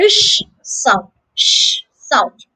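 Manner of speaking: isolated "sh" hush before "sound"; sound demonstration. Shown as a demonstration. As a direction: hold the "sh" as a pure hiss. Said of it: "the snake-like character is".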